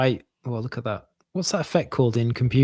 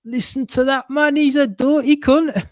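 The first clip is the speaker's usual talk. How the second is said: put-on Geordie accent; 11 semitones higher, 6 dB louder than usual. Reading comic speech bubble.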